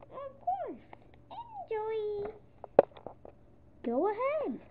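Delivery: high, sweet little duckling voice; high and sweetly polite. Acted. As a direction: innocently give way.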